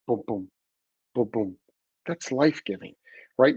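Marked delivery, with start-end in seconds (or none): 0.1-1.6 short double thumps with a pause between; imitated heartbeat. Shown as a demonstration. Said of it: "you think about the heart".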